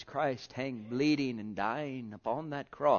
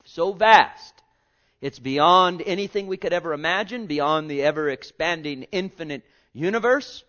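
louder, higher ringing preach; full-force preaching; 5 semitones higher, 10 dB louder than usual. Acted. Preacher exalting God's love to congregation, full force. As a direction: lift the room with it.

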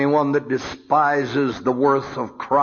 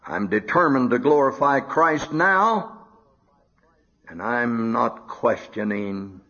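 ordinary talk, nothing marked

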